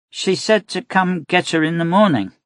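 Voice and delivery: put-on high girl's voice; a child passing on a message; young girl. A narrator's reading. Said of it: "Celine said".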